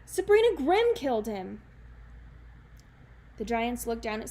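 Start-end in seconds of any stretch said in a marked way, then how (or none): 0.0-1.6 desperate accusation. Acted. Jack blaming Sabrina.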